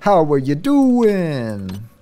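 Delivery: slow, drawn-out flirty Joey; Joey Tribbiani. Retold or imitated. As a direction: stretch it out like Joey. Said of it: "To quote Joey Tribbiani's body double".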